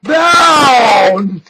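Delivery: startled shout as it spills; sudden yell. Real, not acted. Snuff spills onto desk.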